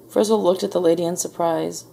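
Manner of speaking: usual speaking voice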